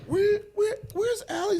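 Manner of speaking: high-pitched, stammered mock searching; retold bewilderment. Retelling waking without his wife, bewildered. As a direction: repeat it high, as if looking around puzzled.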